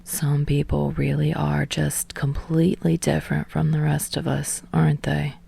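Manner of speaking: muttered to herself, barely voiced; mumbling under breath. Jiro to herself on the curb, whispered under her breath. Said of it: "mumbling under her breath".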